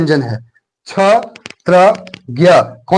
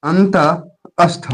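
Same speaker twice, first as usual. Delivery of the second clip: अंतःस्थ drawn out slowly, syllable by syllable, ending on a rise; teacher spelling out अंतःस्थ slowly; slow stressed term; much slower than usual. Shown as a demonstration. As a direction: stretch out अंतःस्थ so students remember it. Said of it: "याद रहे".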